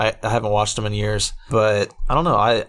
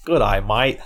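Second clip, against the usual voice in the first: mock-Australian demonstration; deliberate put-on Australian vowels; Australian accent. Shown as a demonstration. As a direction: teach the Aussie greeting, word by word. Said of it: "Say it with me".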